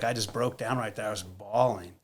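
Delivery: ordinary talk, nothing marked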